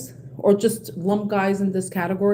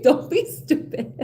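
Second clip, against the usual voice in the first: blunt line breathed out through laughter; can't keep a straight face saying it; laughing; 4 semitones higher, slower than usual. Retold or imitated. Repeating her blunt retort to a friend, laughing. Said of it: "I'm fucking blunt".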